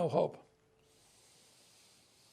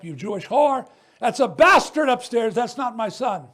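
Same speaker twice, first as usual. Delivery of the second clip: retold drunken yell, louder; drunk father's rant; 8 dB louder than usual. Son retelling the father's abuse: a mild mock drunken yell. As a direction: voice the father's rage, half-acted.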